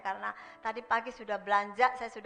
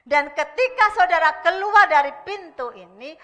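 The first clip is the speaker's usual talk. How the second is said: fiery preaching; 7 semitones higher, 13 dB louder than usual. Acted. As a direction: preach it out hard to the congregation. Preaching at full force. (khotbah berapi-api dengan suara keras dan tinggi)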